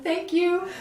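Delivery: high, eager, grateful thank you; relieved thanks. Retold or imitated. To the cameraman who offers help, high relieved thanks. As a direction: gush relief at being rescued.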